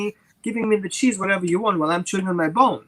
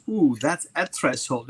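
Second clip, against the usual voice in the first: ordinary delivery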